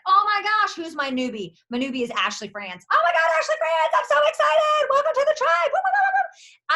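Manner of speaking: very high, loud, squealing delight; squealing excitement. Retold or imitated. Greeting newbie Ashley France in the group chat, squealing high and loud. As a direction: shriek with joy to welcome a newbie. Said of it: "I'm be like".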